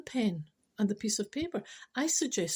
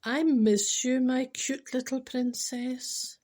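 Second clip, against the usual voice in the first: slow tender read; 3 semitones higher, 3 dB louder, much slower than usual. Acted. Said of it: "and he said".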